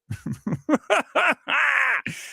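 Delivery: high laugh. Real, not acted. Laughing at film defenders.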